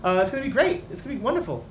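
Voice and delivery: high, nervous, overeager greeting; small alchemist nervously welcoming the adventurers; eager high voice. Acted. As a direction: gush with jittery excitement. Whitnan greets the party.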